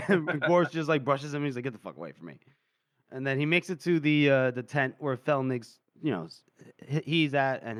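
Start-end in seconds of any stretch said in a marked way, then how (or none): 1.6-2.3 low, quiet dismissal; muttered brush-off. Retold or imitated. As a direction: shrug him off under your breath. Gorse brushing Younger off.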